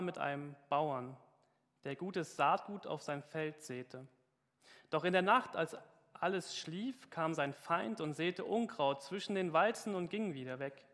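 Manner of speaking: preacher's solemn scripture reading; reading scripture aloud. Acted. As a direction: read the parable out to the congregation, weighty and clear. Reads a parable aloud.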